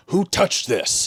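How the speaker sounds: high, slow grumbling dad; angry dad voice. Retold or imitated. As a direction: complain like a cranky dad. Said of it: "you hear a voice say".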